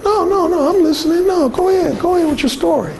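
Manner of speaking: high, fake-polite reassurance; hammed-up polite backpedal; pleasantly phony. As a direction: placate her falsely. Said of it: "pleasantly phony".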